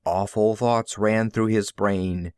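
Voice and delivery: ordinary delivery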